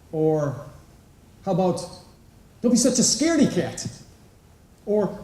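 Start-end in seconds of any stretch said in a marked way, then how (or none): 2.6-3.9 mock parent taunt. Parent scolding a child.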